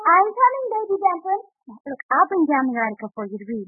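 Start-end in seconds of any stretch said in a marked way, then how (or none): 0.1-1.4 calling out. Real, not acted. Calling upstairs to child.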